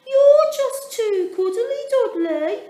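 bright high little penguin voice, loud; high voiced penguin. A narrator's reading. Mother penguin praising Dudley, high and loud. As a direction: squeak it out like a small penguin.

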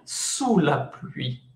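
slow, careful French model; slow teacher demo; slow model phrase. Shown as a demonstration. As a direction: spell it out slowly for the class. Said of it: "este es bajo la lluvia".

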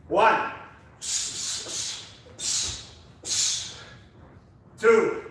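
loud, high rep counting; shouted count. Real, not acted. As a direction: bark the counts over the music. Coach shouting reps in class.

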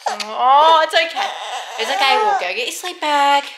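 calm her over the crying. Real, not acted.

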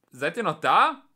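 high, loud call; shouted question over a distance; shouting. A narrator's reading. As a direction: holler to see who answers. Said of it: "schrie einer der Polizisten".

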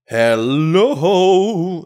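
high, loud shouted call; yell. A narrator's reading. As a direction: call out hard to the empty village. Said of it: "the man yells".

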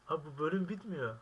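normal talking voice

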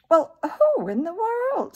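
put-on high, loud, puzzled old lady; startled. A narrator's reading. Old lady inside hears unknown visitors, startled. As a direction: exclaim it in amazement.

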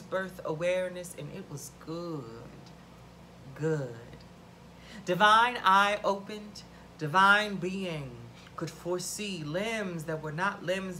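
measured, dramatic creation-myth reading; incantatory performance of a poem; poem recitation. Acted. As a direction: recite it like scripture, with deliberate pauses. Reads own poem aloud.

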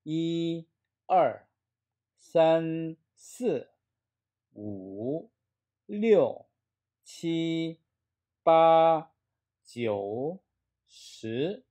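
each of 이, 알, 산 to 시 drawn out separately on its tone; counting drill with tones. Shown as a demonstration. Teacher drills Chinese numbers for students, separate exaggerated tones. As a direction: chant each Chinese number alone, pitch tracing its tone. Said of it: "해볼까요?".

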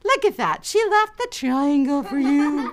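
high, slow, pointed coaxing; lightly acted mother making her point; approving mom. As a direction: show the older sister how kind this is. Mother praises the sharing.

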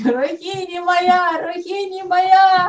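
high, drawn-out wail of a call; full-voiced calling. Interpreter echoing Krishna calling for help, drawn-out shout. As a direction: cry the name out, holding the last vowel. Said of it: "он стал во весь голос орать".

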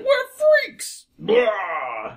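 high, loud monster cry; roaring mutant. Acted. New mutants at the freeze frame, roaring. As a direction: screech it, then roar.

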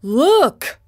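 sudden high call of alarm; Tin Woodman crying out. Acted. Tin Woodman calls out, spotting the Scarecrow in the river. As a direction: shout it out across the river. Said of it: "cried out".

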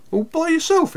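high, surprised little sister; surprised girl. A narrator's reading. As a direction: gasp it out in disbelief. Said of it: "exclaimed Lou".

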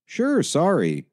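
obliging phone helper speaking up. A narrator's reading. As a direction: apologise brightly, speaking up for the listener. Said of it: "David's voice was instantly louder and yet too conversational".

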